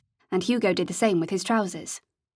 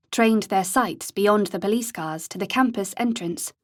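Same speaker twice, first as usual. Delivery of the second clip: plain reading voice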